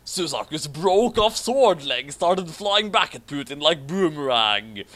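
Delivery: usual speaking voice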